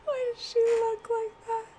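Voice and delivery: high, giggling through the words; can't stop laughing at the picture. Real, not acted. Streamer laughing at Kyoko's noodle hat, giggling.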